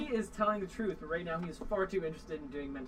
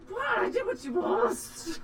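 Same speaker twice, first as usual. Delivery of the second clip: higher, slow, shouted protest; indignant protest; 13 semitones higher, 5 dB louder, much slower than usual. Acted. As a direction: cry out wronged. Goblin protests, shout heard.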